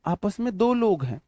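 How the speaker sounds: ordinary talk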